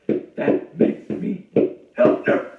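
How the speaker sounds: loud, slow, drawn-out proclamation; emphatic declaration. Pretend. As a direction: declare it grandly and slowly. Mock-proud declaration of being elder.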